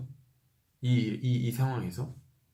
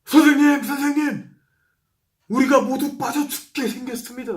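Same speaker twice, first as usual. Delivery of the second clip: high, loud frantic plea over the storm; panicked cry; 11 semitones higher, 6 dB louder than usual. Acted. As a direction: cry out in terror to wake him. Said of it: "깨우며 말했습니다". (폭풍 속 겁에 질려 스승을 깨우는 외침)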